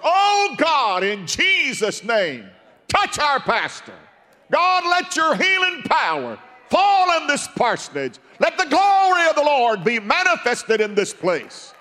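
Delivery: shouted, high-pitched urgent prayer; loud, raucous prayer. Acted. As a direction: imitate elders crying out to God at full voice. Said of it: "They were loud and raucous".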